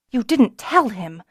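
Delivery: mild angry interjection. A narrator's reading. Kelsa interrupts Beeman, mildly angry and betrayed. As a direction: break in, hurt and sharp. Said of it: "Kelsa broke in angrily".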